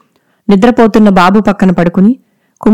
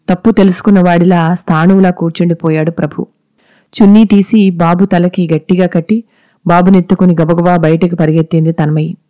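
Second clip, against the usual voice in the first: ordinary delivery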